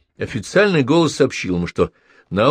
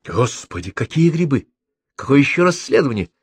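surprised; 4 semitones higher than usual. A narrator's reading. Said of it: "удивилась Елена Петровна".

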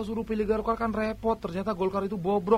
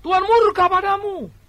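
higher, louder prophetic warning; prophet's shout; 9 semitones higher, 7 dB louder than usual. Retold or imitated. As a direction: thunder the judgment like an Old Testament prophet. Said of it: "Nabi Amos itu teriak". (teriakan nabi yang lantang)

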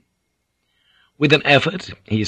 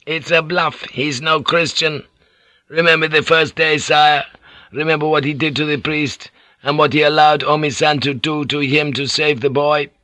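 higher-pitched, insistent samurai retainer; lightly voiced, doubting retainer; sceptical retainer; 6 semitones higher than usual. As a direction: argue the point to your lord.

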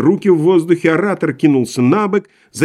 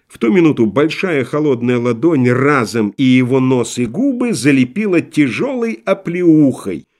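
ordinary delivery, nothing marked

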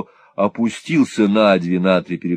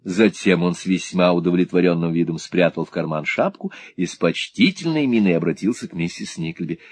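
plain reading voice

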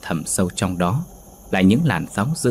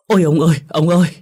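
high, loud shriek of fright; terrified scream; 9 semitones higher, 7 dB louder, faster than usual. Acted. Wife shrieks for her husband after seeing the net, terrified. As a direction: cry out in horror to your husband. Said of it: "rú lên".

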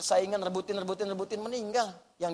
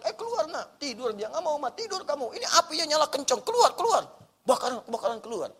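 high, urgent calls to get out; 7 semitones higher than usual. Acted. Parent in a house fire calling the child out, urgent. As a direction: rouse a sleeping child from a fire.

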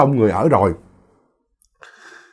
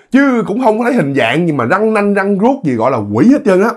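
plain reading voice

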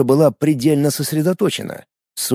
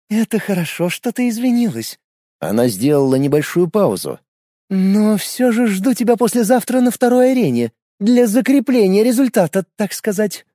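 put-on smug young woman; 8 semitones higher than usual. A narrator's reading. Predatory smile, girl speaks.

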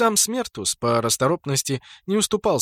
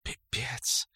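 whisper; 10 dB quieter than usual. Acted. Luna swears in shock.

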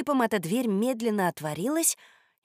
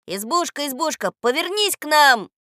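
loud, high chanting call; 7 semitones higher, 6 dB louder than usual. A narrator's reading. Boy commanding the hut to turn round, calling out. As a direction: call out a fairy-tale command. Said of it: "И он закричал".

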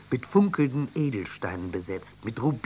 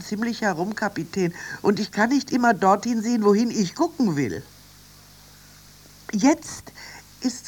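high, excited figure voice reporting wonders; high-pitched, wide-eyed running commentary; Annie, excited lookout; 9 semitones higher, 6 dB louder than usual. Acted. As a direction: marvel at each new sight underwater.

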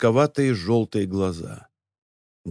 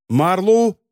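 raised-pitch narrated cry; mild shout; 6 semitones higher, 3 dB louder than usual. A narrator's reading. Charlie, blinded by light, calls his friend, mild cry. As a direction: call out higher, without full force. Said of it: "закричал Чарли".

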